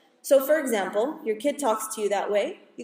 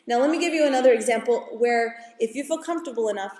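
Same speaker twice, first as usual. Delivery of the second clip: normal talking voice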